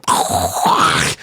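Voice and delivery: long hissing spray-can sound; imitated spray noise. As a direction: make a rushing, throaty hiss like shaving cream spraying. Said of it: "I just hear, like".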